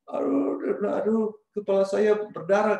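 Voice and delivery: high, whimpering mock cry of pain. Injured friend in the back crying that his head bleeds, high whimper. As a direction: imitate him wailing about his bleeding head. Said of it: "dia menangis".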